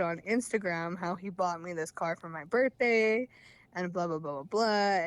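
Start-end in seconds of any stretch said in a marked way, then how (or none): none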